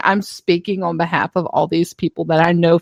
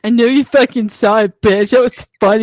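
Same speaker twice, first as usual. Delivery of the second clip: mock indignation; 3 semitones higher than usual. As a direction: sulk at the followers, playfully. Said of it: "I'd be like".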